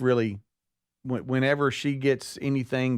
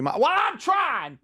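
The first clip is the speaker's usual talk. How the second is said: mom yelling; much slower than usual. Retold or imitated. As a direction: yell it out, stretched and exasperated. Mom yells back at dad.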